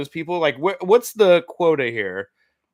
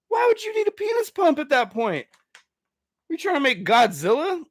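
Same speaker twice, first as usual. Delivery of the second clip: high, strained disbelief; incredulous squeak; 10 semitones higher than usual. Real, not acted. Hearing about the pump, he squeaks in disbelief. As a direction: push the question way up in disbelief.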